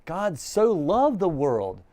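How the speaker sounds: slow, loud and high, leaning on loved; fervent proclamation; passionate. Acted. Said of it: "it is a passionate event".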